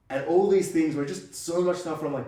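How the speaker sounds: ordinary delivery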